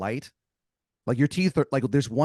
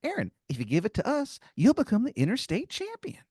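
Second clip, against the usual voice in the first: squeaky tooth making an offer; tempting tooth creature; 13 semitones higher than usual. Acted. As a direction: tempt him like a tiny mob boss. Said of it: "Maybe the tooth goes".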